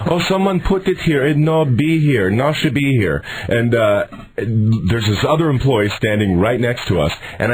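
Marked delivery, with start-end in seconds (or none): 0.1-3.2 put-on raised, broken-English clerk accent; exaggerated accented excuse; mock foreign voice. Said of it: "do the voice like you were doing it".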